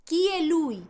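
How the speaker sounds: "Chi è lui" as kee-eh loo-ee; Italian pronunciation. Shown as a demonstration. Teacher drilling "who is he", "Chi è lui" with k. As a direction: say "Chi" with a hard k. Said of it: "todos los ejemplos sin interrupciones".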